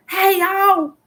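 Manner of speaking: high, loud call down the river; hollering ahead to the boys. Retold or imitated. Mother calling to her sons paddling ahead, loud call.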